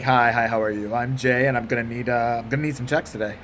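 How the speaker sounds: low, even, polite professional voice. Shown as a demonstration.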